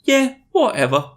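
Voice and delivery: high-pitched dismissive shrug; shrugging acceptance. Acted. British agreeing to date her, with a shrug. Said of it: "He shrugged".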